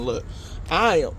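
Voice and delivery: ordinary delivery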